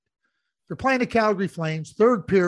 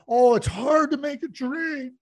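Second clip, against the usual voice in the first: mocking whine; 4 semitones higher than usual. Host mocking a general manager's excuses, a mock whine. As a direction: sneer at the excuse, hamming it up. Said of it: "You know".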